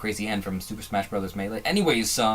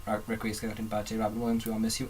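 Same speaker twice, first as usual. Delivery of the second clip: quiet and sincere; 8 dB quieter than usual. Real, not acted. Tribute to Robin Williams.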